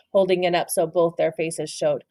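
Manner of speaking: ordinary delivery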